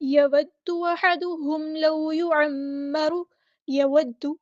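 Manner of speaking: يَوَدُّ with the دّ read twice, held; slow Quranic chant; tajweed recitation. Shown as a demonstration. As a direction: press the doubled د. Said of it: "دو بار پڑھیں گے".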